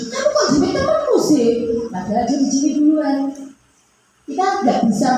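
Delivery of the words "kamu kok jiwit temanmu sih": high accusing "Kamu kok jiwit temanmu sih?" with "kok" stressed; judgmental mom. Acted. Judging mother scolding her child, high accusing tone. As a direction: accuse the child right away. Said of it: "suka menghakimi".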